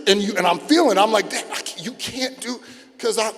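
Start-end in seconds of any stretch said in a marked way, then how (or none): 1.3-2.6 strangled, broken-off plea; acted choking. Son pinned by dad, mock choking. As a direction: gasp out words as if choked. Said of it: "something pushing up on my jugular".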